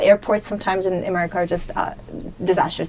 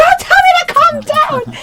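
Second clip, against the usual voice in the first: much higher, louder, slow furious yell; furious shout; 5 dB louder, slower than usual. Retold or imitated. Snapping at the driver who told her to calm down, high, loud and slow. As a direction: scream it back at him, stretched out.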